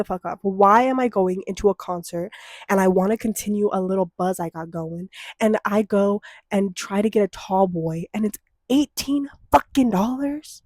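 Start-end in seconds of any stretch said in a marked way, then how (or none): none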